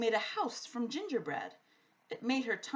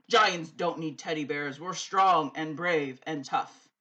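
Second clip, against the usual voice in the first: sulky giant; 5 semitones lower, 8 dB louder than usual. A narrator's reading. Sulking giant answers.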